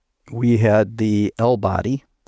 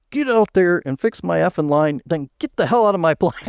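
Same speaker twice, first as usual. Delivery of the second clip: pitched-up imitated tirade at a contractor; hammed-up Marine boss yelling to get out; imitating a furious plant boss, higher; mock angry boss; 7 semitones higher than usual. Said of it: "And he goes".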